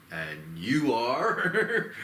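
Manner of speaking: very slow, laughing challenge; drawn-out question. Retold or imitated. Kong meets the Scar King with a slow amused question. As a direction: stretch it out, amused. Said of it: "and he's like".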